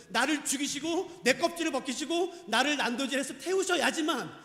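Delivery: loud, driving list of punishments; forceful preaching. Real, not acted. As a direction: hammer each clause home from the pulpit. Preaches God's wrath on sin.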